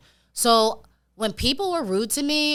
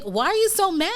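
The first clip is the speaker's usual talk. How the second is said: much higher-pitched retelling of a baffled reply; acted incredulous question; 8 semitones higher than usual. Cold caller to cursing homeowner, acted incredulous. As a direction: act out a high-pitched, puzzled protest.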